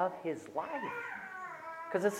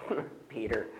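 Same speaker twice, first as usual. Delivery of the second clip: mocking with a laugh; 5 semitones lower, 6 dB quieter than usual. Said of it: "we turn it and go".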